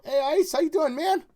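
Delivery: loud, high-pitched shout of hello; calling out greeting. Real, not acted. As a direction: call out to a friend who just arrived. Greeting a viewer arriving in chat.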